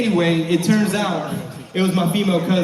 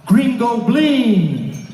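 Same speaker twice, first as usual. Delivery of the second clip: high, long drawn-out street holler; mock yell, slow and high; 4 semitones higher, 3 dB louder, much slower than usual. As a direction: imitate someone hailing from afar, stretching the words. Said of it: "somebody yells out".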